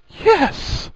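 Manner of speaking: high-pitched, loud yelp; startled outburst. A narrator's reading. Harry answering Hermione wide-eyed, startled yelp. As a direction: blurt it out, aghast.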